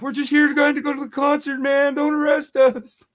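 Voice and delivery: whiny mock pleading. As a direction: beg like a scared kid caught by police. Pleading with the cop.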